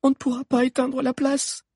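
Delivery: very high, anxious character voice; high-pitched dismay; despairing murmur. A narrator's reading. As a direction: admit helplessly that the plan fails. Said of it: "murmura Agnès".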